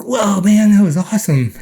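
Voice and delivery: high and loud, thrilled retelling; bursting with excitement at the memory; excited exclamation. Retold or imitated. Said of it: "I'm like".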